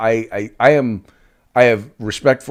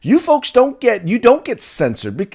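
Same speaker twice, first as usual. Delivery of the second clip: retold retort to friends; 8 semitones higher than usual. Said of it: "And I said".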